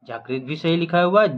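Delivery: normal talking voice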